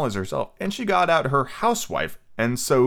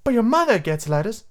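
put-on high girl voice; 12 semitones higher, 4 dB louder than usual. Wendy voiced.

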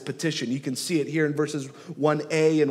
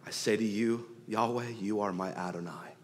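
hushed prayer; 6 semitones lower, 9 dB quieter than usual. Acted. Quiet prayerful voicing of David.

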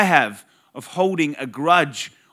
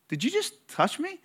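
retold disbelief, pitched much higher; incredulous question; 14 semitones higher, 5 dB quieter than usual. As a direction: ask it in high, mock-shocked disbelief. Teacher confronts student who touched him.

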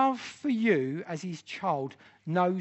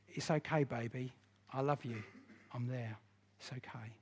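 tender hush; 4 semitones lower, 9 dB quieter than usual. Pretend. Pastor quotes God comforting softly.

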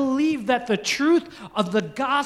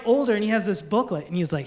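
ordinary delivery

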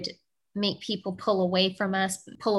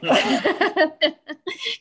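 bright high laugh, a bit louder; real laugh; 9 semitones higher, 4 dB louder than usual. Real, not acted. Co-host laughing along at the crying story, real laugh. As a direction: let a real laugh burst out.

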